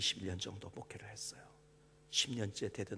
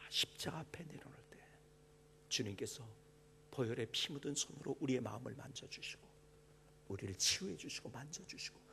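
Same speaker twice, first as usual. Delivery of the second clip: hushed preaching. Acted. Pastor assuring the congregation God knows them, in a hushed whisper. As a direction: lean in and breathe the words to the flock. Quiet intimate sermon opening.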